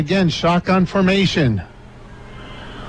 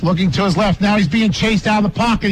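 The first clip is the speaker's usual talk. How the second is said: ordinary talk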